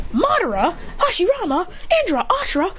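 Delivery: each name pitched high in surprise; startled recognition. Acted. Hero spotting old legends, high startled recognition. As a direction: exclaim name after name, voice jumping up. Startled roll call of arrivals.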